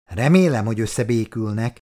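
ordinary delivery

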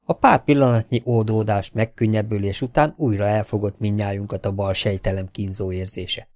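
plain reading voice

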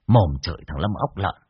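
usual speaking voice

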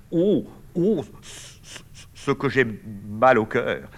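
slow, stretched-out queasy moan; nauseous groan. Acted. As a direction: drag out the words feeling sick. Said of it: "soutenu par Francine". (voix nauséeuse, très lente)

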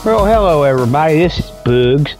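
normal talking voice

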